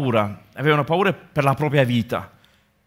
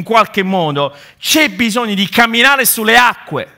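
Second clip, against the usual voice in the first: più acuto e più forte, predicazione appassionata; predica infervorata; 5 semitones higher, 5 dB louder than usual. Acted. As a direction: incalza l'assemblea con fervore crescente. Preaching at full force. (predica infuocata, voce alta e forte)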